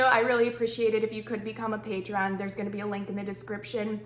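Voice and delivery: ordinary delivery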